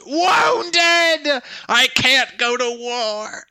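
high, slow, hammed-up dying lament; having a heart attack. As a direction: moan as if clutching your chest. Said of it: "I'm gonna fall on the ground and clutch my chest".

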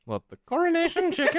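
high, loud hammed-up British accent; mock posh British shout. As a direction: squeal it like a bad English impression. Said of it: "my horrible attempts at a British accent".

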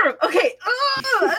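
a real strangled groan of frustration, then a cry; frustrated groan. Real, not acted. Fed up with the redesign, a frustrated cry. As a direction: let the groan burst out, fed up. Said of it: "Okay, I'm calming down".

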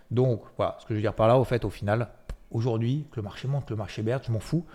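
usual speaking voice

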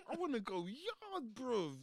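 slow, loud whine; loud pleading. Retold or imitated. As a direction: drag out the plea. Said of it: "every day I'm telling these man".

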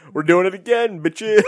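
high, loud mocking yell; loud gleeful taunt; gloating shout. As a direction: crow it out like a smug publisher. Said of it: "And they're like".

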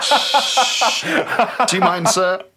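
shushing moviegoer. Acted. Cinemagoer shushing Elliot.

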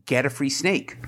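usual speaking voice

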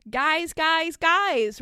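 high, loud joyful call; boy shouts to his family in delight; joyous screech. Acted. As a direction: yell across the hall with glee. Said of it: "Ronald screeched joyously".

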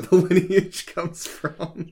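chuckling through the joke; laughing. Real, not acted. Joking about redhead giant lineage.

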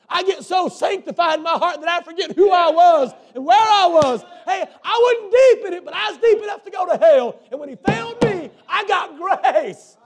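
high, loud preacher's shout; full-force preaching. Real, not acted. Preacher crying out his own testimony to the church.

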